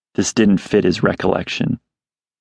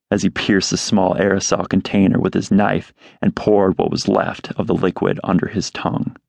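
plain reading voice

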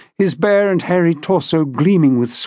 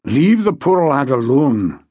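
slow, drawn-out protest in a character voice; remonstrating major; 3 semitones lower, slower than usual. A narrator's reading. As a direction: remonstrate slowly on the lad's behalf. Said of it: "that remonstrance".

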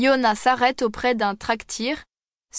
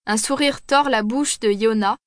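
plain reading voice

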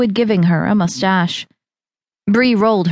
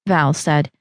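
normal talking voice

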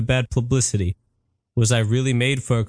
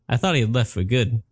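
ordinary delivery

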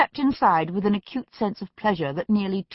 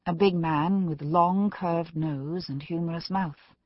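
usual speaking voice

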